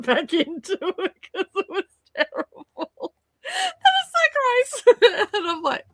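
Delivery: high laughing. Real, not acted. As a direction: squeal with laughter. Laughing at gross coffee.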